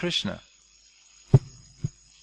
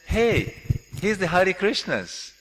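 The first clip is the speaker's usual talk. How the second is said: light teasing call, a bit higher; tossing off a joke while walking with a friend; joking jab; 7 semitones higher, slower than usual. Retold or imitated. Friend jokingly points out the devotees, light teasing tone.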